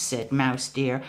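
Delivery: usual speaking voice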